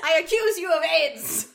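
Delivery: high, loud, slow melodrama; overacted soap villain; mock dramatic accusation. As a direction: ham up the accusation like a soap opera. Mocking the bad line.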